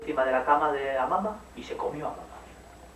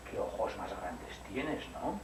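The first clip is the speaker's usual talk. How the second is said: slow, soft, wondering; drawn-out amazed question; wondering girl; 5 semitones lower, 7 dB quieter, much slower than usual. Acted. Caperucita to the wolf in bed, slow wondering. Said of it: "le dijo".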